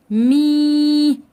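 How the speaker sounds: مي held long and loud as "meeee"; elongated syllable. Shown as a demonstration. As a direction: stretch the "ee" of مي on a steady note. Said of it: "مدت حرف الميم عشان يبقى حرف ممدود".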